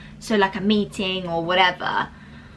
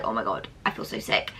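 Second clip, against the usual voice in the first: low, breathy, queasy groan; groaning sick; 10 semitones lower, 4 dB quieter than usual. Retold or imitated. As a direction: moan it like you feel awful. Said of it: "I'm like".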